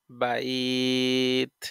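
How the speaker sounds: বাইত with a very long held glide before the final t; two-alif madd lin. Shown as a demonstration. Teacher demonstrating madd lin, glide held very long. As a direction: hold "baai" on one tone, then close বাইত. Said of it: "তাকে দুই আলিফ মাদে লিন কয়".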